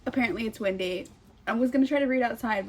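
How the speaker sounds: ordinary talk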